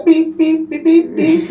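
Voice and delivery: high bleeps in place of swearing; playful censor beeps; mock censor bleep. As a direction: bleep out the curses in a squeaky voice.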